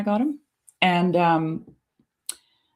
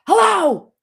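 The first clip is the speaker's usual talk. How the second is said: insistent parrot hello; 13 semitones higher, 7 dB louder than usual. Retold or imitated. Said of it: "he goes".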